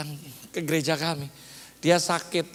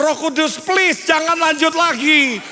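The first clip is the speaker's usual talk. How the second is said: high, loud mock begging; acted desperate plea; pleading prayer; 7 semitones higher, 7 dB louder than usual. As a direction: beg heaven to stop, stretching the words. Begs Holy Spirit to stop.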